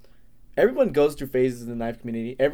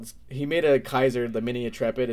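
normal talking voice

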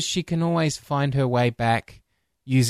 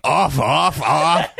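seal voice; 3 dB louder, slower than usual. Retold or imitated. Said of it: "They're saying".

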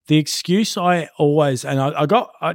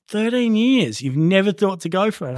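higher, amazed question; mock disbelief at the answer; incredulous; 7 semitones higher than usual. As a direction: examiner stunned by the long wait, higher pitched. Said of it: "And he's like".

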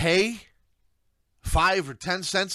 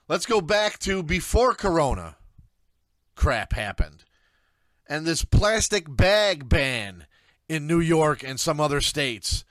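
ordinary talk, nothing marked

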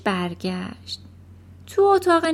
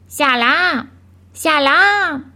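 loud, ringing bird call; same loud voice; 5 semitones higher, 8 dB louder, much slower than usual. A narrator's reading. Hidden bird calling again as family approaches, loud and ringing. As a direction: call each greeting out, high and strong. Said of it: "بار دیگه همون صدا بلند شد".

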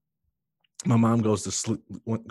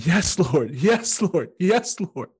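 rising, higher and higher cries of praise; joyful screaming; 9 semitones higher, 4 dB louder, much slower than usual. Retold or imitated. Newly saved man cries out to God, rising joyful shouts. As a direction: shout it out in ecstatic relief. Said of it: "I just kept screaming".

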